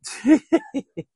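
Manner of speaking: sudden high-pitched laugh; high burst of laughter. Real, not acted. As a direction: laugh out loud in surprise. Big laugh at the remark.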